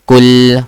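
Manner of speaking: KUL, short u and held l, loud; correct pronunciation. Shown as a demonstration. Teacher modelling Arabic word for student, correct pronunciation. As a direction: hit KUL and hold its L. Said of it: "How do we say?".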